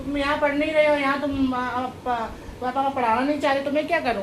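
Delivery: loud, high scolding. Real, not acted. Aunt loudly scolding her nephew about his studies. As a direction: tell him off in exasperation.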